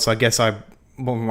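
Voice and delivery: ordinary talk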